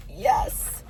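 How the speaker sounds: loud, gleeful yes; excited yes. Real, not acted. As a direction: cheer it out. Delighted about hot cider.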